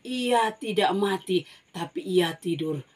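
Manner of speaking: Jesus to the mourners, firm slow command. Acted. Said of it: "Tuhan Yesus bilang begini".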